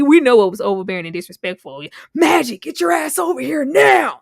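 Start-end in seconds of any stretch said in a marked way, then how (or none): 2.1-4.2 loud barked order, a bit higher; mock coach shout. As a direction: yell it at the player across the court. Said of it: "that was too much".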